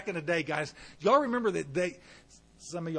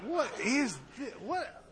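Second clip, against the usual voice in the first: high, slow, incredulous what; stunned disbelief, pitched up; mock shock; 8 semitones higher, slower than usual. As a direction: act stunned by the question. Shocked reaction to an odd question.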